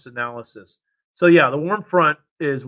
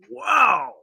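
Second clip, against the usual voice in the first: amazed wow; 9 semitones higher than usual. Real, not acted. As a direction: blurt it out in awe. Amazed at the saturated air mass.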